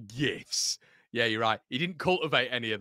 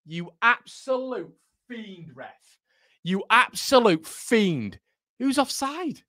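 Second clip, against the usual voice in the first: high, slow, drawn-out indignation; outraged at referee; 9 semitones higher, 5 dB louder, slower than usual. Real, not acted. Fan berating the ref over a decision, high outraged cry. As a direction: stretch each word out in disbelief.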